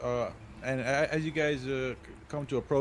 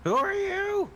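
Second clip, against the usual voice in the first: through a door; 4 dB louder, slower than usual. Acted. Lion knocker demands name again.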